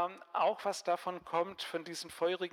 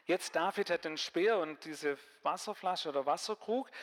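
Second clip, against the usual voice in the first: ordinary talk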